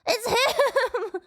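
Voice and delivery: high, laughing exclamation; giddy, laughing shout of recognition. Real, not acted.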